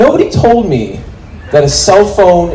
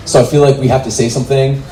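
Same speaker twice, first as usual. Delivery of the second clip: ordinary talk, nothing marked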